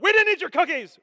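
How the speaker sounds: loud, high guilty denial; defensive outburst. Acted. Guilty cookie thief denying it to the baker, defensive yell. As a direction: blurt an over-loud indignant denial. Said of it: "They're all defen".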